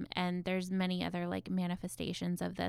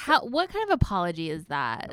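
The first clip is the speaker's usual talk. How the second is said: indignant outburst; 9 dB louder than usual. Real, not acted. Outraged at mother's apology.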